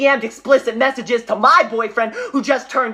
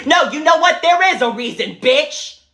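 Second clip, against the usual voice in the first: sudden loud, higher snap; furious outburst; 5 semitones higher, 4 dB louder than usual. Acted. Teacher blows up at condescending colleague, furious outburst. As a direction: finally lose it at her.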